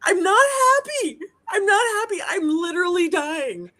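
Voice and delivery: high, cracking angry outburst; furious, tearful. Real, not acted. Daughter answering her mother back, high and tearful. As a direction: lash out through tears.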